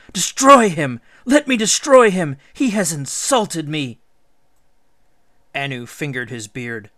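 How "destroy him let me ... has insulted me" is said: loud, high wailing demand; sobbing rage. A narrator's reading. Ishtar pleading with her father to destroy Gilgamesh, sobbing fury. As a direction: beg furiously for revenge. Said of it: "she sobbed".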